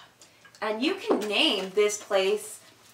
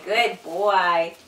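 ordinary talk

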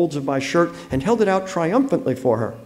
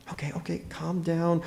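much softer, slightly raised pitch; placating; 4 semitones higher, 9 dB quieter than usual. Acted. Husband calming his angry wife, softly placating. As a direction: hush it right down to pacify.

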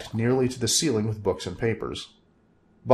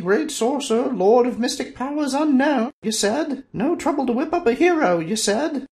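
mock him, pitched way up; scoffing woman; 4 dB louder than usual. Woman mocking the sorcerer's boasts, very high and scornful. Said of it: "she scoffed".

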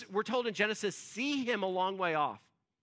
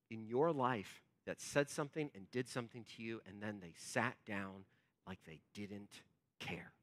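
low, very soft preaching; hushed, solemn; 9 semitones lower, 11 dB quieter than usual. Real, not acted. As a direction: let the voice sink almost away. Preacher dwells on brothers' indifference.

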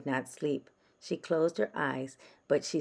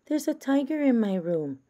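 put-on high, louder child voice; little girl voice; 9 semitones higher, 4 dB louder than usual. A narrator's reading. Francis tells her parents about the tiger, high child voice. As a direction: announce it like an excited small girl.

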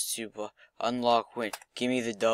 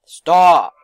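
much louder, higher shout; exasperated yell; 6 semitones higher, 18 dB louder than usual. Real, not acted. Gamer yelling at targets in his game, exasperated. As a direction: yell it out in frustration.

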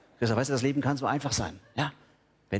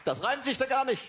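indignant complaint; 9 semitones higher than usual. Acted. Complaint about a bad rhyme.

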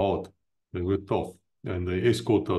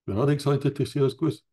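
higher-pitched mock disbelief; mock-questioning in a raised voice; imitated disappointed guest; 7 semitones higher than usual. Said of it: "and they're like".

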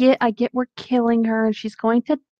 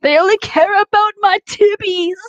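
high, loud, whiny character; whiny girly voice; 12 semitones higher, 5 dB louder than usual. Acted. Podcaster plays a pouting character, high and whiny. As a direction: whine it like a pouty diva.

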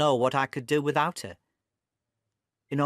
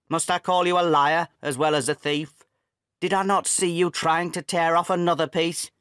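angry accusation; 5 semitones higher, 5 dB louder than usual. Acted. Father accusing son of theft.

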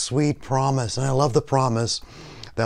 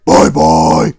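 deep, loud goofy bye; puppet sign-off; 7 semitones lower, 14 dB louder than usual. Pretend. Puppet echoes the goodbye to viewers, deep booming voice. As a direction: boom the goodbye down low.

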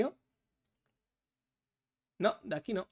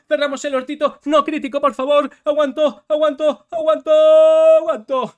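loud, high, tense hanging on; tense shouting; 6 semitones higher, 13 dB louder than usual. Real, not acted. Streamer bracing under attack, loud and tense. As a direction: shout it through the pressure.

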